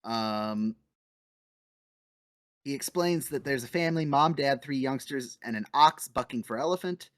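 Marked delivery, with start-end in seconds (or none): none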